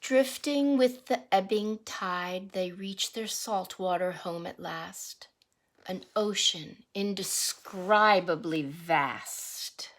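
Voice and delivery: rhythmic picture-book read-aloud; reading to children. Acted. Teacher reading a salmon picture book to the class, sing-song read-aloud. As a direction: read the verse to the class with a lilt.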